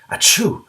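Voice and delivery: big hammed-up dragon sneeze; loud sneeze. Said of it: "Every time the gray green, green dragon sneezed".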